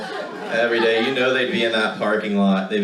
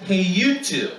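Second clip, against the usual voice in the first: put-on bratty kid brother; 7 semitones higher, much slower than usual. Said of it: "I come rolling along and I say".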